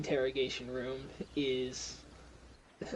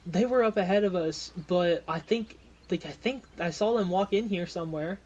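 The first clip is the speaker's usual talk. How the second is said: lightly voiced, higher game character; Molly in conversation; 5 semitones higher, 6 dB louder than usual. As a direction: answer in character, a little raised. Game master voices character replying.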